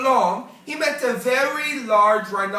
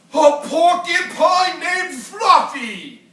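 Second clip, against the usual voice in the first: loud, slow, higher mocking howl; howling laughter; 6 semitones higher, 8 dB louder, slower than usual. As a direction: crow it out in glee. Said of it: "howled the rhinoceros".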